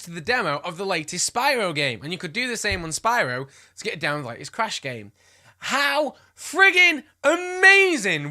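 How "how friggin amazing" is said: loud, high and stretched out slowly; emphatic excitement. Real, not acted. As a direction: gush it word by word. Gushing over an imagined hidden demo.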